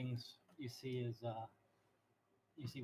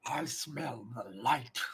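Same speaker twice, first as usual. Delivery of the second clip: slow, loud sniffing wolf; 7 dB louder, much slower than usual. Acted. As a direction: draw it out like a beast. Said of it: "that's a terrible voice I'm doing".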